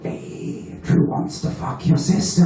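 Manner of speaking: hushed whisper with a giggle in it; conspiratorial whisper. Retold or imitated. Inner voice whispering to stir up a hungover grudge. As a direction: whisper it like a devil on the shoulder.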